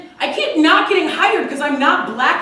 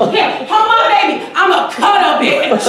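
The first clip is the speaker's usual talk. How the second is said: louder, higher sassy voice; sassy woman; 6 semitones higher, 5 dB louder than usual. Acted. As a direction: throw attitude at the crowd.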